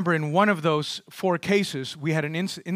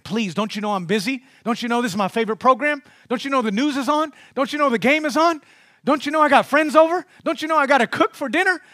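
higher and louder with each brush-off, irritated; annoyed parent; 7 semitones higher, 5 dB louder, faster than usual. Pretend. Busy parent to nagging child, rising exasperation. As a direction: push the child away, getting more exasperated.

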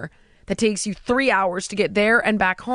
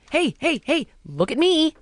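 mock show-off calling out, pitched up; hammed-up "notice me" plea; attention-seeking; 5 semitones higher than usual. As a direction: imitate someone begging for eyes on them. Mimics attention-seeking social media posters.

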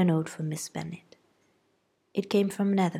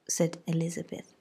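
plain reading voice

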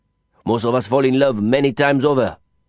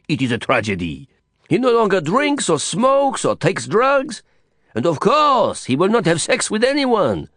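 higher, theatrical French lament; a Frenchman hamming up his outrage at a friend's virtue; mock disgust, French Didier; 8 semitones higher than usual. A narrator's reading. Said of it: "clearly disgusted".

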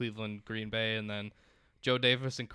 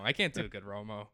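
ordinary delivery

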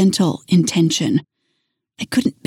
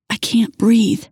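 plain reading voice

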